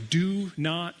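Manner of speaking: very slow, each word held apart; slow emphatic. Shown as a demonstration. Preacher repeating the command to the church, very slow. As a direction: lay it down word by word. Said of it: "Let me say it in a different way".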